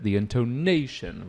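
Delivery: "intonation" with NAY swooping up, then falling; demonstrated intonation. Shown as a demonstration. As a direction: lift and stretch the NAY in "intonation". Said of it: "the way you do the intonation".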